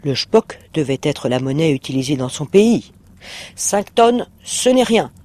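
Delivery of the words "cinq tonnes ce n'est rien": ton rusé, plus haut, l'air de rien; fais-le passer pour une broutille, en souriant. A narrator's reading. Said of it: "avec un sourire rusé". (le démon minimise, rusé et souriant)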